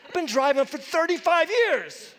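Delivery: loud, high self-scolding; a driver chewing himself out after hitting a curb; angry at himself. Retold or imitated. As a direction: bark at yourself in frustration. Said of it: "I verbally said out loud".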